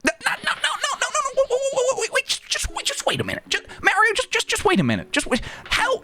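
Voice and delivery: very high, frantic squeak; panicked Luigi. Retold or imitated. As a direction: squeal a string of no's in panic. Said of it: "what's Charlie Day".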